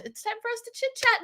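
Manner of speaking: high sing-song announcement; chirpy hostess call. Real, not acted.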